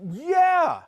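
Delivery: loud, high shouted "yeah"; emphatic agreement. Retold or imitated. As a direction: cry it out in total recognition. Customer's agreement peaks, retold.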